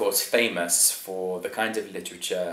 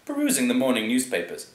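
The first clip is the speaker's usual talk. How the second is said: ordinary talk, nothing marked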